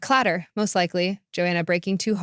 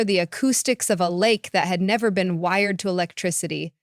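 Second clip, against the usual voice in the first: plain reading voice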